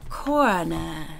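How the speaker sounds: CORNER with no final r, "caw-nuh"; low, drawn "CORNER" ending in "nah"; mocked pronunciation. Real, not acted. Echoes word without final r.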